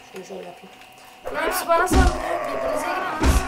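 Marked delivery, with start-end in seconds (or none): 1.3-3.4 loud, higher yelling while swarmed; alarmed shout. Real, not acted. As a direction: cry out as everyone attacks at once. Attacked by everyone in arena. (saldırı altında bağırarak)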